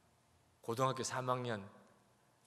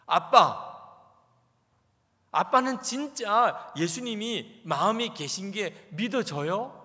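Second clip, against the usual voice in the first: higher, louder, slowed desperate cries; shouting daughter; 8 semitones higher, 10 dB louder than usual. Retold or imitated. Daughter shouting at her pastor father in doubt, loud and higher. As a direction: yell at dad that you can't believe. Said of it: "막 그렇게 소리소리 지르는 거예요".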